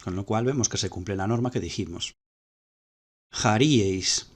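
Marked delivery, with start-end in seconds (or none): 3.3-4.3 "Jarieis" stressed on the second-to-last syllable, RI; paroxytone stress. Shown as a demonstration. Teacher citing an adjective, "Jarieis" with paroxytone stress on RI. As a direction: lean on RI in "Jarieis". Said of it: "acento paroxítono".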